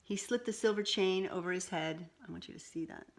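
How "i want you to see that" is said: quiet aside. Real, not acted. Aside to child.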